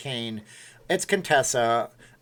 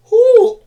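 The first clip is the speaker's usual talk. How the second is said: high squeal; 16 dB louder than usual. Pretend. Squeal amid the coughing.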